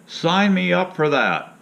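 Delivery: sarcastic. Real, not acted. Sarcasm about drug side effects.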